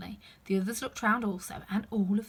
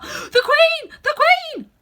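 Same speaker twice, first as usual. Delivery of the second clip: much higher, loud alarmed cry; calling out; 15 dB louder, slower than usual. Acted. Five shouts warning of the Queen, high and loud. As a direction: shout the warning across the garden. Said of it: "called out".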